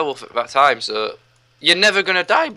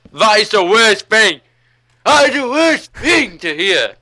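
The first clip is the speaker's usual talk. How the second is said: higher, louder and slower, hammed-up despair; exasperated outburst; 9 semitones higher, 5 dB louder, slower than usual. Sales assistant to listeners, exasperated and loud. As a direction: drag out the horror of that request.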